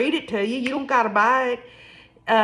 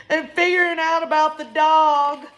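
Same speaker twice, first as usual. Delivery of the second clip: imitated weeping; 7 semitones higher than usual. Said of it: "emotionally weeping".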